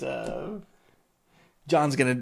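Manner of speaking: usual speaking voice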